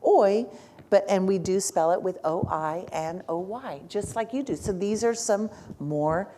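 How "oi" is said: "oi" said on its own, slightly higher and louder, as a teacher models the sound; sound demo. Shown as a demonstration. Teacher models the Spanish sound "oi" for the class, sounded out clearly. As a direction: set "oi" apart and sound it out clearly. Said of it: "So we have that sound".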